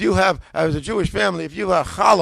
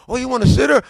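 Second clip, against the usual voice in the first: high, loud question of recognition; New York saleslady; 7 semitones higher, 8 dB louder than usual. Retold or imitated. Bookseller lady naming the prayer book, high and loud. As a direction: catch on brightly as the saleslady. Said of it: "She said".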